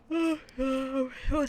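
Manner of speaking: yawned word; said through a yawn. Real, not acted. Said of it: "sorry for yawning so much".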